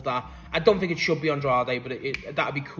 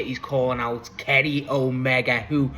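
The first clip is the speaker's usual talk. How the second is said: ordinary talk, nothing marked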